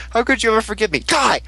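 high, rising unhinged threat; crazy sidekick girl. Retold or imitated. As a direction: ham up a crazed villain girl. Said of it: "and she's like".

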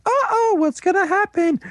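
very high-pitched teasing worry; mock suspense. Storyteller teasing the next card, in high mock suspense. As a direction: squeal it in pretend suspense.

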